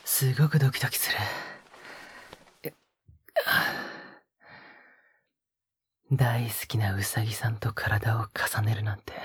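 breathy close-mic whispers with a teasing edge; a lover's hushed, teasing murmur; intimate ASMR whisper. Acted. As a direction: whisper right into the listener's ear, coaxing. Intimate whispered roleplay throughout.

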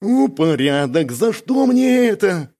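slow, high, drawn-out lament; pained whine. A narrator's reading. Бяка lamenting his companion's bragging, pained whine. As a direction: wail it slowly with a grimacing voice. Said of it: "скривился Бяка".

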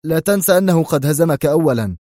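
angry comeback; angry retort. Acted. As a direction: fire it back. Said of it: "هتف مارياني في غضب".

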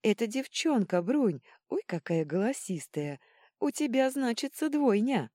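high, loud, overjoyed cries; tearful outburst. A narrator's reading. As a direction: gush the happy news at the mother. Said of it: "вдруг разревелась Ванила и обняла Бруни". (восторженно, на грани слёз)

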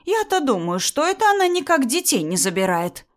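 high, knowing indignation; mock-wonder high as if it all fits; accusing sarcasm. Mother-in-law insinuating about the children, sarcastic.

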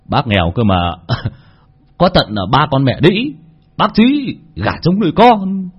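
sly taunting voice, rising high; mocking jab. A narrator's reading. Old Khang taunting the widower, sly and mocking. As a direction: needle him with a sneer. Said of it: "càng được thể mà cạnh khóe".

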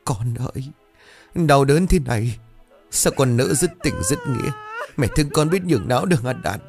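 high, tearful sobbing plea; crying lament. A narrator's reading. Mother slumped against the wall, crying for her son. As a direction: sob the words out through tears. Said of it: "Bà Ngọc khóc than thành tiếng".

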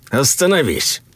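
high, urgent order to halt; urgent command. A narrator's reading. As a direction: call out to stop someone. Ordering the intruder to stop.

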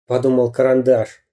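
ordinary delivery

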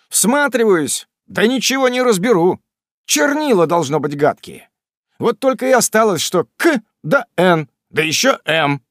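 a hint of cheeky son ribbing his father; teasing son. A narrator's reading. Sam teasing his fretting father, lightly mocking.

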